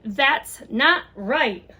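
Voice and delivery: slow, firm stand against unfairness; brave objection. Acted. A child bravely calling out racism, slow and firm. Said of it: "by bravely saying".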